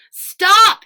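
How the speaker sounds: sharp loud high shout of stop; yelled. Acted. Boy yelling at the bulldozer driver, loud shout. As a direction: shout it to halt the machine. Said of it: "he yelled".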